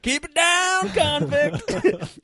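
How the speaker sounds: high-pitched imitation of a yelling cop; high, comic holler without full volume; mock yell. Cop banging coffee mugs in the sketch, mock yell. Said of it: "one of 'em yells out".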